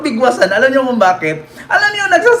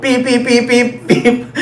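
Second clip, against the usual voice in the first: car horn; slower than usual. Retold or imitated. Imitating car horn while driving.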